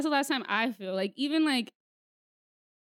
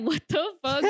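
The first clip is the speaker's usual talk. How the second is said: laughing; 6 semitones higher, 5 dB louder than usual. Retold or imitated. Retelling shock at unwanted video.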